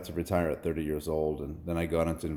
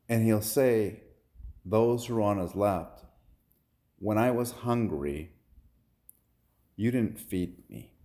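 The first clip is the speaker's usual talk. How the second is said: scripture preaching; 2 semitones higher than usual. Retold or imitated. Man recites judgment day to fiancee, solemn preaching.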